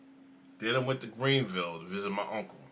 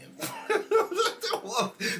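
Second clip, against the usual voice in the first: big laugh. Real, not acted. Laughing at the chat.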